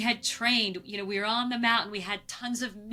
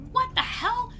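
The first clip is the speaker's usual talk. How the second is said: startled disbelief; 13 semitones higher than usual. Retold or imitated. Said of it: "I'm like".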